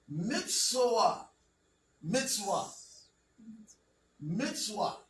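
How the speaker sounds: "Mitzvah" with a W sound, as mits-WAH; ancient Hebrew pronunciation. Shown as a demonstration. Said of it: "That's how you would actually pronounce it".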